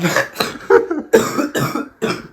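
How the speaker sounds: harsh coughing fit from illness; sick cough. Real, not acted. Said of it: "I'm really sick".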